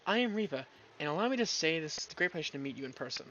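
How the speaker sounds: lightly voiced starstruck fan, halting; a hint of a nervous fangirl meeting her idol; shy fangirl. Fangirl Reva stammering to her idol, lightly voiced.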